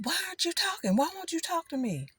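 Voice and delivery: high pleading mother's voice; imitating mother. Mother upset her child won't talk, high pleading. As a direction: plead like a hurt mom asking why.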